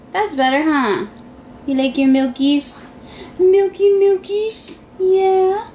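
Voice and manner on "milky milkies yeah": playful, higher-pitched mommy voice. Real, not acted. Mother baby-talks to toddler about milk.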